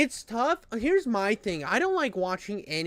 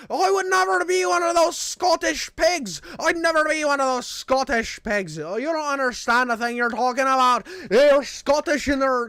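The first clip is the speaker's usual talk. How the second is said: high, loud mock Irish rant; hammed-up furious shouting in an Irish accent; irate Irishman impression; 7 semitones higher, 6 dB louder than usual. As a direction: go off like an outraged Irish customer. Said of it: "dude goes off on me".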